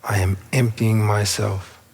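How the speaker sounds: unhurried meditation guide with long pauses; spacious, measured affirmation leading; guided meditation. Real, not acted. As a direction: guide them inward, phrase by phrase. Leads a seated meditation.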